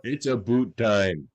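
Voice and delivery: drawn-out hammed-up Canadian accent; mock Canadian accent. Co-host ribbing Canadians on a podcast, mock Canadian accent. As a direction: do a slow joke Canadian accent. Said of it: "the accent they use to make fun of Canadians".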